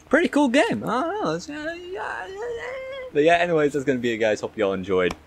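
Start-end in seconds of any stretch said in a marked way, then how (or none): 0.1-2.7 squeaky voice. Pretend. Goofy sign-off squeal.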